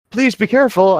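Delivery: loud high call across the lake; yelled warning. Acted. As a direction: shout after them to be careful. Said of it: "I yelled".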